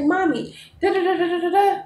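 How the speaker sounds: mock excited child babble; imitating eager son. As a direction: mimic a kid rushing to ask. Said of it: "He like".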